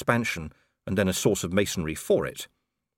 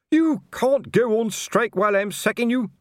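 much higher, loud apoplectic yell; furious shout; 5 dB louder than usual. A narrator's reading. Colon yelling at the striking watchmen. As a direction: shout them down.

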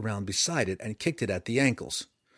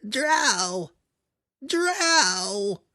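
much higher, louder raspy creature cries; frantic rasping gnoll; 11 semitones higher, 5 dB louder than usual. A narrator's reading. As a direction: gasp the word out in panic while fleeing. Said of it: "it rasped over and over".

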